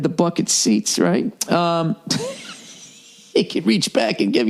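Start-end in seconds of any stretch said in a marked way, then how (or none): 2.0-2.5 shrill burst of a laugh; high squeal laugh. Real, not acted. Laughing at own joke.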